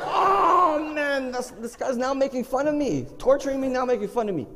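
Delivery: high-pitched comic whining; whiny complaint. Retold or imitated. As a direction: whine as the mocked victim. Complains about being made fun of.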